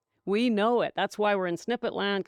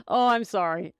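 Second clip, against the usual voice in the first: normal talking voice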